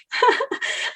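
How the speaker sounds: airy high giggle; breathless giggle. Real, not acted. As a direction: giggle without voice. Laugh after slip.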